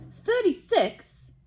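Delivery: put-on high, slow, whiny boy voice; sulky Dudley. A narrator's reading. Dudley counting his presents, slow whiny boy voice. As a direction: drag it out like a spoiled kid. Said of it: "he said, looking up".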